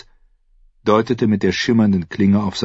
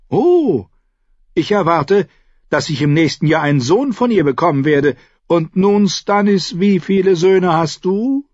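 high-voiced, boastful younger brother; Renly, smug; 12 semitones higher, 5 dB louder than usual. A narrator's reading. (hohe, selbstgefällige Stimme)

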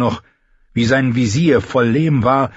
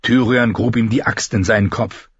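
plain reading voice

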